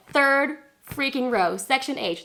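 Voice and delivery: "Third freaking row" slow, high and punched; emphatic confirmation. Retold or imitated. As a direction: hammer each word of "Third freaking row" with excitement. Confirming amazing seats to mom.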